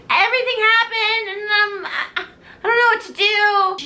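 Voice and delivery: high, wavering sing-song; mock tearful confession; hammed-up helpless whine; whimpering. [name] sobs to mom.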